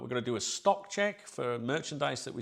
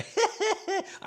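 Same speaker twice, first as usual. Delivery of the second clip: high burst of guilty laughter; giggle, caught out; sheepish laugh; 4 dB louder than usual. Real, not acted. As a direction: laugh at your own cheek. Laughs at own admission.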